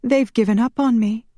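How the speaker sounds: high, despairing woman's voice; distressed cry. A narrator's reading. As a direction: lament it, pitched high. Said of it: "Marielle cried".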